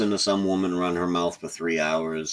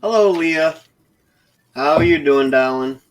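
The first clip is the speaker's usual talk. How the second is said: higher, louder singsong hello to a viewer; cheery greeting; 9 semitones higher, 7 dB louder than usual. Real, not acted.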